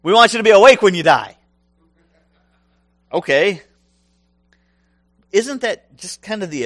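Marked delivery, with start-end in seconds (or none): 0.0-1.4 mock reasoning. Mocking the disciples' logic.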